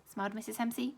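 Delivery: usual speaking voice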